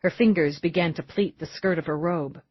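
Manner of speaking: usual speaking voice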